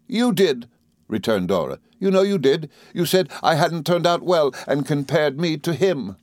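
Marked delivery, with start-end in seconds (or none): 0.1-0.7 high, petulant wife voice; Dora, insisting. A narrator's reading. Wife contradicting husband, high and petulant. 2.0-6.2 high, petulant wife voice; Dora, hurt and insisting. A narrator's reading. Wife contradicting husband, high and petulant.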